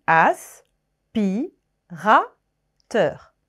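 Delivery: "As", "pi", "ra", "teur" with a pause after each; syllables split. Shown as a demonstration. Teacher splitting the word for pupils, syllable by syllable. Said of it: "on entend quatre syllabes".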